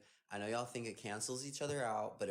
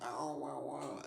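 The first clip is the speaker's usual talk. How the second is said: put-on slightly raised baby babble; imitating a baby; 3 semitones higher, slower than usual. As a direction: mimic a baby's little sounds. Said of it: "he was like this".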